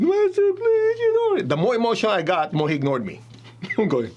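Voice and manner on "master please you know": high, louder pleading wail; desperate begging. Retold or imitated. Student begging his master, high and desperate. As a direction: wail the plea for help. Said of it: "I begged".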